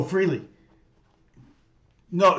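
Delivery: usual speaking voice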